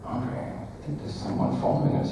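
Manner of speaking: hushed, secretive tip-off; hushed warning. Retold or imitated. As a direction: lean in and warn him quietly. Leaning over to warn Andre.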